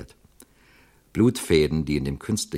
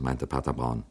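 plain reading voice

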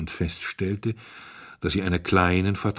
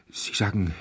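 halting false start, repeated; stammering. A narrator's reading. Stunned hotelier turning to the duke, stammering. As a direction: get stuck, pause, try once more. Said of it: "stotterte er".

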